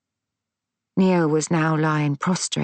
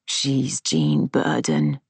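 much lower, slow, failing voice; low dying rasp; 13 semitones lower, slower than usual. A narrator's reading. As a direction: drag the name out of a dying chest. Dying man accusing, very low.

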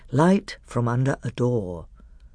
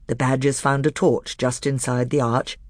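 ordinary delivery

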